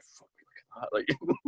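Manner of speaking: slow hushed whisper. Retold or imitated. Whispering to his friend about the outfit. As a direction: breathe it out, barely voiced. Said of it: "the first thing I whispered".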